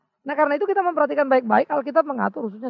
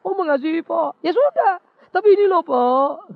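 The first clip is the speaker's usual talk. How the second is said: higher, louder eager churchgoer excusing himself; mimicked churchgoer; 5 semitones higher, 8 dB louder, slower than usual. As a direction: play a member who says yes but has a complaint. Congregant answers the preacher.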